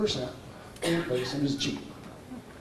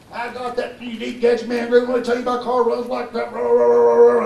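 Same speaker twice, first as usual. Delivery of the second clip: screaming imitation; 6 semitones higher than usual. Irate customer screaming down the phone about his car, mock screaming. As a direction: imitate an angry caller, voice pushed up high. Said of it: "he was screaming at me".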